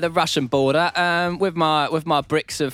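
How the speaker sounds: high hammed-up British variety comic; Steve. Steve explaining himself at the Russian border, high comic impression. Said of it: "me doing an impression of Steve".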